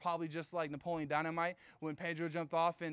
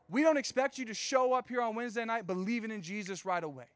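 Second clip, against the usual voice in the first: usual speaking voice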